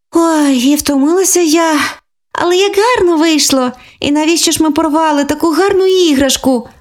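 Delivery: put-on high, childlike, a little worn out; catching her breath after sewing; mildly tired little girl; tired girl's voice. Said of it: "переводячи подих від важкої роботи".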